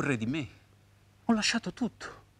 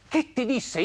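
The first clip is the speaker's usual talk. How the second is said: high, full-voiced repeat of the question; insistent question; 7 semitones higher than usual. Man presses the woman about his wife's words, insistent question.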